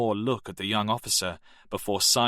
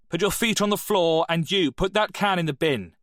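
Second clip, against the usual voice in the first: a much higher, louder stern command; stern deputy head; 9 semitones higher, 5 dB louder than usual. Acted. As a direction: cut the noise dead with authority.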